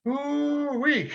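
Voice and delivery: high, laughing ooh-wee of amazement; delighted whoop. Real, not acted. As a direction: whoop with joyful disbelief. Host reacts to big result.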